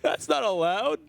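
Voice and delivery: high-pitched, hammed-up protest; Exna impression. Caster objecting to a second Ragnaros, Exna impression. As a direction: cry foul in a squealing mock outrage. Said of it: "That was my best, my Exna impression".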